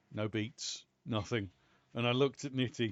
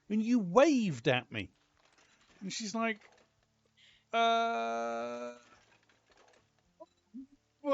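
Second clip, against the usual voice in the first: loud, high retold argument; raised indignant storytelling; animated retelling; 9 semitones higher, 6 dB louder than usual. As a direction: relive the quarrel with indignation.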